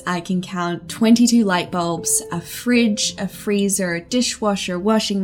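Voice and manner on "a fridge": ordinary talk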